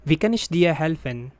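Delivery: Wie kann ich dir helfen said quickly, stress on kann; natural speed. Shown as a demonstration. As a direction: say it at full native pace. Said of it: "phrase said at natural speed first". (quick native-speed read)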